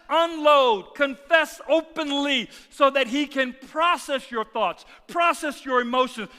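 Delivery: loud, high, emphatic sermon delivery; full-force preaching. Real, not acted. Preacher urging the congregation to confess to God, full-force preaching. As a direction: drive every phrase home to the congregation.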